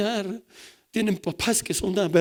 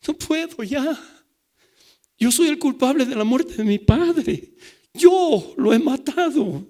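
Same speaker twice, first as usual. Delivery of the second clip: louder, higher, crying out the guilt; anguished confession; 8 semitones higher, 7 dB louder than usual. Retold or imitated. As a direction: voice the boy's despair, slowing at the end. Boy's confession retold by pastor.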